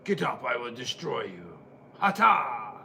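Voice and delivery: low growling wrestler taunt. Pretend. Voicing wrestler action figures.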